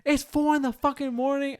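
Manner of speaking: high, sustained retold scream at ordinary volume; mock screaming. Neighbour yelling at boyfriend at night, mock screaming. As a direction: imitate her outraged shriek without full volume. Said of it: "she was just screaming like".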